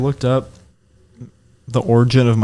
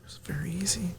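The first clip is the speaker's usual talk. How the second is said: unvoiced whisper, barely audible; 5 semitones higher, 16 dB quieter than usual. Real, not acted. Answering his friend in a whisper. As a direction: breathe it out as a whisper.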